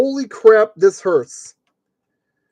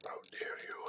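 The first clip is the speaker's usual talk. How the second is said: very soft, scandalised whisper; hushed start of a hammed-up tirade; whispered mock outrage; 27 dB quieter than usual. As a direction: whisper it as if deeply offended. Mock outrage at the kit, building.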